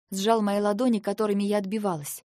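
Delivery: plain reading voice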